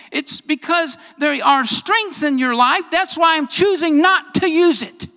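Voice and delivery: high, loud, driving sermon delivery; impassioned preaching. Real, not acted. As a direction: preach it hard, hammering the key words. Preaches at full force.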